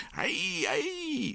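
high, drawn-out squeal of pain; howling boy with his ear pulled. A narrator's reading. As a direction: yelp "ow" far up in pitch. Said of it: "hurlait Alexandre".

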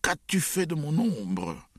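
high, stretched-out accusing cry; slow, outraged high-pitched howl; furious yell. A narrator's reading. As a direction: wail the accusation in rage. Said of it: "hurla oncle Vania".

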